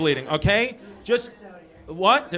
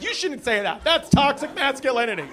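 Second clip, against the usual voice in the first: scolding heckler; 8 semitones higher, 6 dB louder than usual. Retold or imitated. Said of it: "Someone's like".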